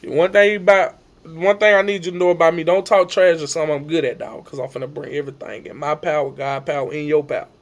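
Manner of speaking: ordinary talk, nothing marked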